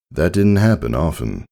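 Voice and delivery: usual speaking voice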